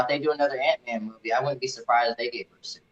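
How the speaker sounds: ordinary talk, nothing marked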